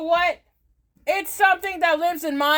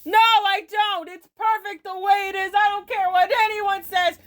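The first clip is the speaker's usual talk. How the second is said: ordinary talk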